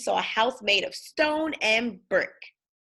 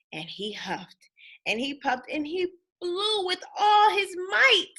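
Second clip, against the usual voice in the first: building effort; 8 semitones higher than usual. A narrator's reading. Wolf blows house down.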